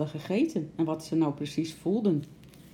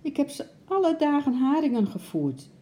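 high-pitched, insistent defence; distressed cry of denial; exclaiming protest; 11 semitones higher, 4 dB louder than usual. A narrator's reading. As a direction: protest it in a raised, upset voice. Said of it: "riep Bart".

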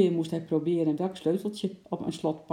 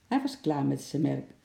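plain reading voice

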